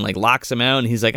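ordinary delivery

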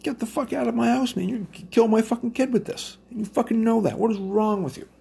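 high, heated telling-off; angry retort. Retold or imitated. Speaker kicking a guest out, high and heated. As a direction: throw him out of the house, high and angry.

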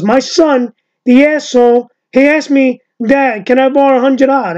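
put-on high, loud Italian American accent; Uncle Louie. Imitating the comedian's tale about his son, Italian American accent. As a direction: play an exasperated Italian American dad, hammed up. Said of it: "he goes".

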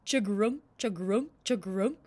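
loud croaking frog call. Acted. Frog croaking at the couple, loud and throaty. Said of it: "The frog went".

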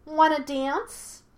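high, drawn-out invitation; teasing monkey spirit. A narrator's reading. Monkey spirit teases Yue, high and drawn-out. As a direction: tease with a slow offer. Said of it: "He waggled his tail lewdly".

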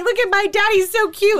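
high, loud, tearful-sounding gush over photos; gushing, choked-up mock. Bride gushing over her wedding album, mock tearful.

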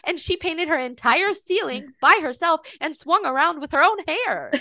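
high-pitched mock indignant argument; retold emphatic defence; imitating upset kid. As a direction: argue the case like an outraged child, lightly. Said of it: "was very upset".

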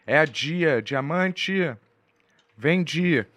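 high, put-on calling voice; hammed-up high sing-out to a friend; mock nickname calling. Mocking use of a friend's nickname.

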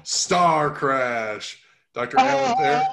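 high, loud showman introduction; ringmaster. Pretend. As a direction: present him like a circus act. Showman intro, later said plainly.

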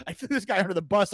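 ordinary talk

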